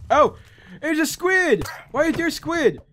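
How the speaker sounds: high-pitched surprise; startled. Real, not acted. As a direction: exclaim in baffled alarm. Startled by squid in game.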